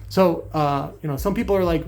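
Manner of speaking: usual speaking voice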